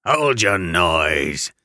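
slow, low, booming convict growl; terrible voice. Acted. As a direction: bark it like a menacing convict. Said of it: "cried a terrible voice".

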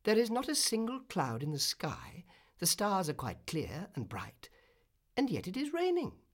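a touch of disbelief; puzzled cry. A narrator's reading. Swallow under a clear sky, mildly baffled by rain. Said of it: "he cried".

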